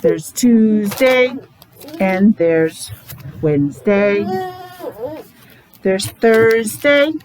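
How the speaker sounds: drawn out, each day slowly; slow it right down for the kids. Shown as a demonstration. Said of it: "let's go slow".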